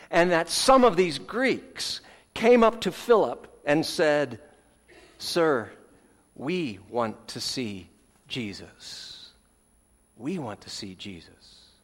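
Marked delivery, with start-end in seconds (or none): 5.2-11.7 soft, lower, slowly voiced plea; hushed quoted request. Greeks asking Philip to see Jesus, hushed request. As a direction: quote it gently, dropping softer on the repeat.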